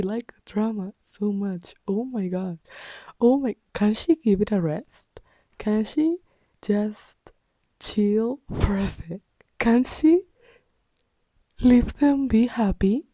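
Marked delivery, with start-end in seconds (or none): 3.2-13.0 raised, higher-pitched exasperated plea; worked-up reader begging for some peace; exasperated pleading. Real, not acted. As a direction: plead in rising questions, fed up. Exasperated rant pleading for a character.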